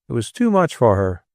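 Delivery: usual speaking voice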